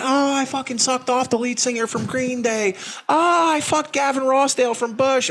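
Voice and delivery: put-on high, gushing valley girl voice; bragging groupie. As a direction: brag like a starstruck groupie. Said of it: "It's like".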